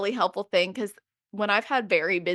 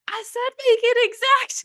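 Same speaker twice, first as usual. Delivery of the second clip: much higher and louder, demanding the app obey; exasperated mock yell; 5 dB louder than usual. Venting at the meal-plan app, mock-angry higher yell. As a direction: tell the chatbot off in a mock yell. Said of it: "I can be like".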